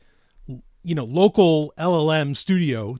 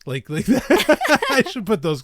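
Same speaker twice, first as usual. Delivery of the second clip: laughing; 7 semitones higher, 3 dB louder than usual. Real, not acted. Giggling over kinky AI art.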